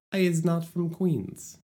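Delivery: mock your own Queens accent; joking Queens accent, self-aware. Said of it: "I don't know why I'm doing this accent".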